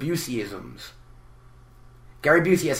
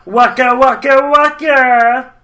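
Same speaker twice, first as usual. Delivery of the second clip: much higher, loud, slow bear catchphrase; Fozzie impression; 9 semitones higher, 10 dB louder, slower than usual. Shown as a demonstration. Fozzie's catchphrase, higher and louder. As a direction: belt it like a hopeful comic bear. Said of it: "You gotta get the waka".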